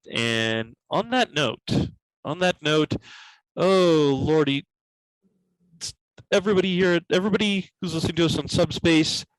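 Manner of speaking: ordinary talk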